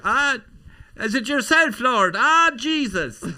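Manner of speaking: very high, loud put-on wail; hammed-up pleading to a lord, ending in stutters; mock-deferential outburst. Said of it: "I just felt like".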